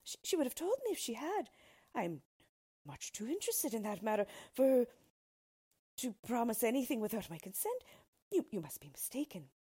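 high, flustered princess voice; indignant royal denial; protesting princess. A narrator's reading. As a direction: protest in disbelief, starting high and loud, easing at the end. Princess denies the betrothal.

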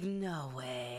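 low-pitched exclamation. Shown as a demonstration. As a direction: drop NO WAY well down in pitch. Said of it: "We say it lower".

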